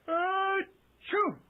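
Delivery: slow build of uh, then a loud high achoo; drawn-out sneeze. Acted. Magician fighting a sneeze, slow build to a loud sneeze.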